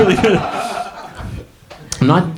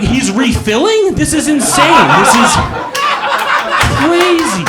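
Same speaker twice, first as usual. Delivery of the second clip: incredulous; 10 semitones higher, slower than usual. Retold or imitated. Watching the toilet guy order dinner, rising laughing disbelief. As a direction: climb higher with each line, amazed.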